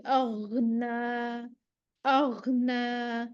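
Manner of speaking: اغنا drawn out very slowly with a flowing غ; slow recitation. Shown as a demonstration. Teacher recites اغنا slowly for the class. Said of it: "آواز دبا نہیں دیں گے".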